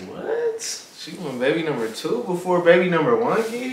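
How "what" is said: surprised. Real, not acted. Reacting to baby number two.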